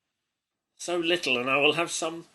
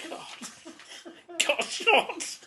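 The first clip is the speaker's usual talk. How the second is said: high, breathy mock-shocked gasp; mock horror squeak; 13 semitones higher, 5 dB quieter, much slower than usual. Reading a child's story aloud, a high mock-shocked squeak.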